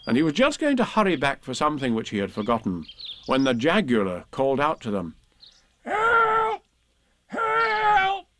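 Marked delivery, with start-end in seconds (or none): 5.9-8.3 very high small voice calling out; a long, squeaky cry for help. Acted. Jagular calling from up the tree, high-pitched cry for help. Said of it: "it called".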